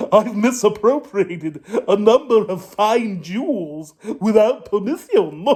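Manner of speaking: giggling through the boast; a rascal boasts to the captain, laughing. Acted. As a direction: chuckle while bragging.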